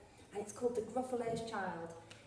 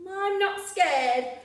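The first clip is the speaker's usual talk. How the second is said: slow, loud, higher insistence; defiant child; 7 semitones higher, 10 dB louder, slower than usual. Acted. Gruffalo's child boasting in the snowy wood, slow loud defiant. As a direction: shout bravely to hide fear.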